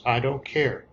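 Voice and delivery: ordinary delivery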